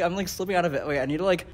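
ordinary talk

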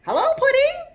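very high, loud cheery hello; butterfly greeting. A narrator's reading. Toast greeting the new snail, cheerily. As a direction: pipe up as an eager butterfly.

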